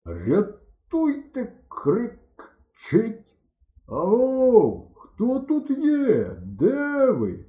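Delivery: put-on slow, high, drawn-out drunken call; tipsy swooping shout; drunken hollering. A narrator's reading. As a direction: holler into the field, stretching each word. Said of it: "загукав Бурмило".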